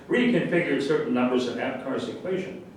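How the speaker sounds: ordinary delivery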